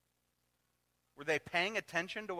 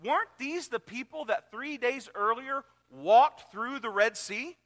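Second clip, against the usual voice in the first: raised, slow preaching voice; 6 semitones higher, 4 dB louder than usual. Acted. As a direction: preach it to the crowd. Preaching at full force.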